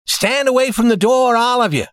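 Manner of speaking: high, projected command. A narrator's reading. Man taking charge at the safe, commanding. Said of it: "he commanded".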